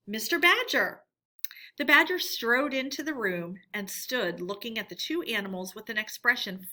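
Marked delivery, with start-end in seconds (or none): 0.1-1.0 loud, high announcement; announcing a visitor. Acted. Mole flinging open the door, announcing loudly. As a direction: call out the guest's name. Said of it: "flung the door open and announced".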